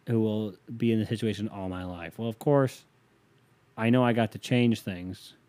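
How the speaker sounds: ordinary talk, nothing marked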